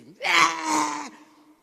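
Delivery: sudden loud high laugh; loud burst of laughter. Real, not acted. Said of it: "big laugh after "I flipped".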